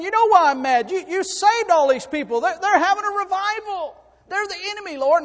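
loud, very high accusing cry; angry outburst. Acted. Prophet blaming the Lord for saving his enemies, angry outburst. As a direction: throw it at heaven in full-force protest. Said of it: "So what Jonah's saying".

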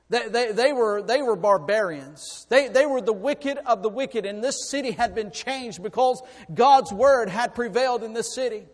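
loud, high, driving sermon delivery; full-force preaching. Real, not acted. Preacher telling the congregation of a changed city, full-force preaching.

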